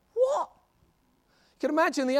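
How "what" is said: a high squeak of disbelief; shocked what. Acted. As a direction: yelp it in total surprise. Angel's shocked reaction.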